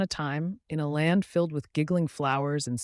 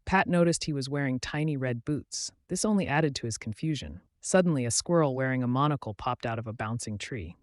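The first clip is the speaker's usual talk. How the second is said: ordinary delivery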